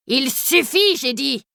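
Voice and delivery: a hammed-up angry stop; a stable director's mild mock roar. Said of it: "rugit le directeur de la grande écurie".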